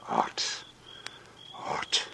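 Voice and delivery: breathy whispered sigh of a word; whispered murmur in the heat; weary whisper. Acted. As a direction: let it out on a tired breath. Tired mason mopping his brow.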